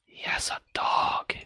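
hushed whispered aside; whispering. Real, not acted. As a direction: drop to a whisper. Sudden aside about Casey.

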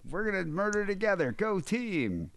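high, chirpy team-spirit voice; mock cheerful killer. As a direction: play it like a cheery cheerleader for murder.

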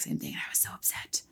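breathy whisper, no voice at all; whispered confession. Real, not acted. Confessing her mac and cheese letdown, in a whisper. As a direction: whisper it like a sad little secret.

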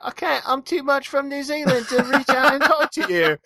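high, hammed-up New Zealand kid; lightly mocking New Zealand accent. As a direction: squeak it out like a Kiwi kid. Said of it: "And the kid's like".

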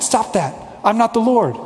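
ordinary talk, nothing marked

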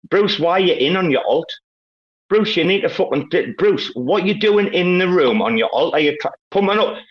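high-pitched, indignant mock rant; mock telling-off. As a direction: call him out in pretend outrage, climbing high. Mock telling-off of audience member.

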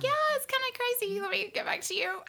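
very high, squeaked stalling reply; awkward high-pitched brush-off; mock evasive. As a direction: put on a high voice to dodge the question. Acting out her evasive reply.

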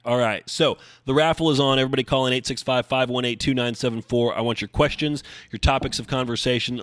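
loud, upbeat host sign-off over music; radio host outro. Acted. Host signing off to listeners, upbeat and loud. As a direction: pump up the listeners and call for callers.

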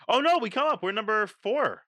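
high, loud delighted discovery; real excitement. Real, not acted. Host telling co-host they do come up, excited. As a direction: burst out with good news. Finds their show in search results.

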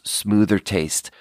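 ordinary talk, nothing marked

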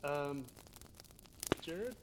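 soft, nervous whisper-talk; scared and hushed. Real, not acted. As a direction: hush it, trying not to panic. Frightened friend whispering in the dark.

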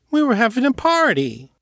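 put-on very high drunken whine; complaining. A narrator's reading. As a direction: protest like a pouting party girl. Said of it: "Kate complained".

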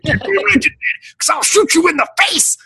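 high, hammed-up gunslinger threat.

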